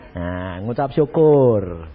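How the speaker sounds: syukur said slowly, low and calm; slow low counsel. Shown as a demonstration. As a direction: settle it gently, slowly.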